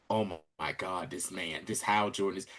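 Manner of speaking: low, soft retold wonder; hushed awe. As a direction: play a kid quietly awestruck. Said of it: "he was in awe".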